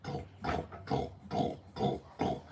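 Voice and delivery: whispered urgent go, go, go; hushed soldier commands. Retold or imitated. Stormtroopers urging each other down the street, in a hushed whisper. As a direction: push the squad forward under your breath.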